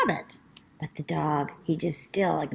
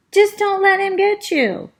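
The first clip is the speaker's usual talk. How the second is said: rabbit voice; 12 semitones higher, 7 dB louder than usual. Acted. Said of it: "She's like".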